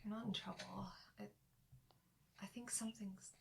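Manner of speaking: soft, halting near-whisper; very quiet, hesitant confiding; hushed reassurance. Acted. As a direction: reassure gently under your breath. Character reassures Shadow privately.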